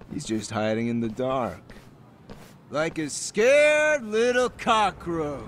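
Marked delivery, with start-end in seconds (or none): 2.7-5.4 slow, much higher, louder sneer; sneering mockery. Gang leader mocking the hiding man. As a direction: stretch out each word in contempt.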